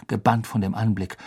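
ordinary delivery

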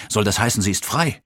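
mildly stunned, rising question; startled question. A narrator's reading. Gunnar asks whether Christina is free, startled and questioning. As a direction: ask in disbelief, lifting at the end. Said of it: "schrie ich unwillkürlich auf".

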